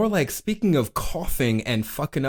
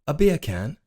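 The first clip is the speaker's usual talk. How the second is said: British accent, beer can with dropped r. Shown as a demonstration. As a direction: say it like a Londoner. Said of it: "Say beer can in British".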